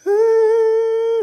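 long, loud, very high bawl; imitated little kid's sustained wail; hammed-up childish bawling; mock crying wail. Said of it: "and I was crying".

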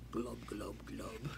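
low, soft glubbing; fish glub. Acted. Teacher imitates goldfish.

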